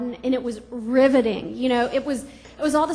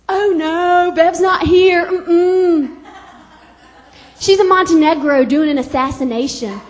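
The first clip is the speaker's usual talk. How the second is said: loud, cheery mom on the phone, much louder; proud chatty mom; 10 dB louder, slower than usual. Retold or imitated. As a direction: mom bragging to the villain on the phone. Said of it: "my mom would be like".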